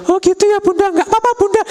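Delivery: put-on high little-child voice; relieved child. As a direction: answer like a relieved kid. (suara anak lega, nada tinggi)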